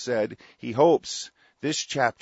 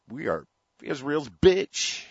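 slow bitter emphasis; 4 semitones higher, slower than usual. Real, not acted. Bitter slowed emphatic line.

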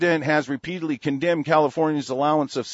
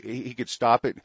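ordinary talk

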